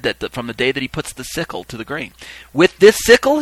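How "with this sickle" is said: high, loud public question; crying out. Acted. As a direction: cry out to the witnesses. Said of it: "he cried out a second time".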